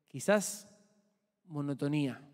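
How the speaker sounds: normal talking voice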